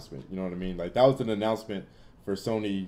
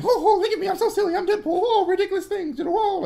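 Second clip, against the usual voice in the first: high squeaky falsetto, louder, with a laugh; mocking Deadpool; 6 dB louder, faster than usual. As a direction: sneer it out like a silly cartoon show-off. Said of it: "always count on Deadpool being the exact sa".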